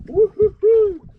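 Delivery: high, slow woo-hoo cheer; excited whoop. Real, not acted. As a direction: whoop with joy. Cheering at a fish.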